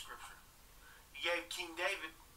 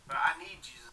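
whispered confession. Real, not acted. Confession of need.

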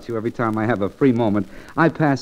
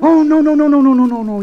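excited protest; 8 dB louder than usual. Acted. Protesting her modesty.

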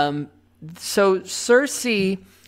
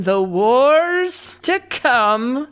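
dramatic announcer; 5 semitones higher, 4 dB louder, much slower than usual. Acted. Announcing episode title dramatically.

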